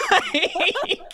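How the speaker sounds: high squeaky word stretched through laughter; laughing disbelief. Real, not acted. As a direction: break into an incredulous, high-pitched laugh on it.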